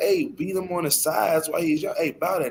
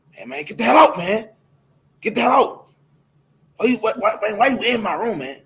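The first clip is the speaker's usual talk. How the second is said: higher, louder angry snap at his mother; snapping son; 6 semitones higher, 4 dB louder than usual. Retold or imitated. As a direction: bark him out of the room like a rude teen. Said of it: "snapping at his".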